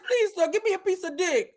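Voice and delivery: high-pitched mock begging urchin; hammed-up beggar's plea in a high voice; Oliver Twist. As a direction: plead like a pitiful orphan, mockingly. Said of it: "They act like she Oliver Twist".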